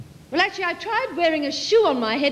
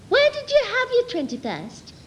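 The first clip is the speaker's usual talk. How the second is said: prim schoolgirl; 6 semitones higher than usual. Retold or imitated. Her quoted question.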